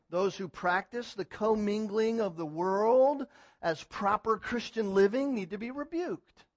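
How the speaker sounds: usual speaking voice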